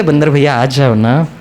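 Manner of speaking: low, sweet call to the monkey; loving coaxing. Retold or imitated. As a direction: imitate a gentle, coaxing plea. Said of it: "बड़े प्यार से बोलो". (प्यार से पुचकारते हुए)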